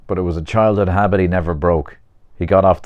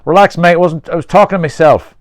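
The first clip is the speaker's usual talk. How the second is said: loud, high-pitched retort; defensive protest; 11 semitones higher, 8 dB louder than usual. Acted. Peter fending off a stranger's challenge, loud defensive protest. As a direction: push back hard at an accusation.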